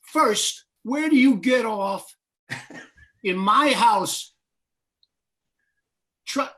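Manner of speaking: loud, slow, furious bartender telling off a customer; angry rant. Acted. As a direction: bark it with outrage. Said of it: "what he's saying is".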